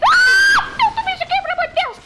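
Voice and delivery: high piercing scream of fright; terrified scream. Real, not acted. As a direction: shriek in sudden terror. Sudden monster, screams.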